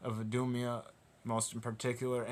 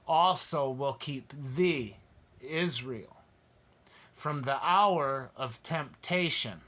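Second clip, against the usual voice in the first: slow, weighty preacher's reading, leaning on thou; solemn scripture reading; slower than usual. Acted. Preacher to congregation, slow solemn scripture reading. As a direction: read each word as holy writ. Scripture read slowly, emphatically.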